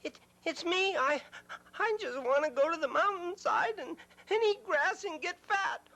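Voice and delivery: timid little goat. Acted. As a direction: squeak it out like a scared kid goat.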